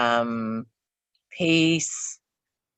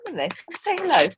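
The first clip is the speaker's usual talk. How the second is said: higher, quick mock-indignant pet voice; cat's voice, playful; voicing the cat; 5 semitones higher, much faster than usual. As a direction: speak for the cat in a huffy little voice. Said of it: "It's like".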